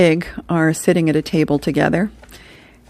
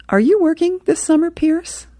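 lightly voiced teen, pitched much higher; a quick, high-pitched boyish question; teen Jeremy; 12 semitones higher than usual. Jeremy asks his friend about summer, in a high teen voice.

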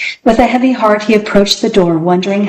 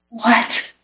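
whispered; 4 semitones higher, 5 dB quieter than usual. A narrator's reading. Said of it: "whispered Alan".

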